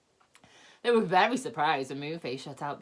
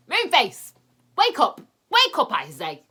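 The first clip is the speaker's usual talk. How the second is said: much louder, much higher shout; 8 semitones higher, 13 dB louder than usual. A narrator's reading. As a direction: yell through the window to wake him. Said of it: "came shouting up".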